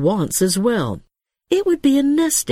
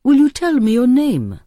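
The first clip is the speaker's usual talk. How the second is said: higher, louder call; raised voice reaching her as she leaves; 6 semitones higher, 4 dB louder than usual. A narrator's reading. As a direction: call the question across the distance. Man calls after departing woman.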